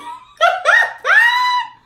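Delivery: high screaming burst of laughter; loud shrieking laugh. Real, not acted.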